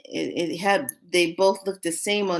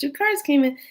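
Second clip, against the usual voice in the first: higher, louder call; imitating the owner; 9 semitones higher, 4 dB louder than usual. The owner turning to her, a higher voice. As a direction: voice the owner, pitched up. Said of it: "turns and says".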